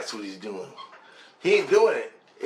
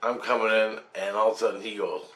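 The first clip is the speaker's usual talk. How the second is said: normal talking voice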